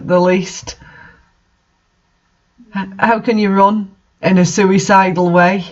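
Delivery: normal talking voice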